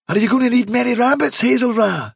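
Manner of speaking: quick eager rabbit voice; Dandelion's eager question. Acted. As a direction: rush the question out.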